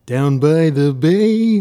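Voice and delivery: slow, drawn-out singer impression. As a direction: croon it slowly as a mock folk-singer impression. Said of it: "it's him being like".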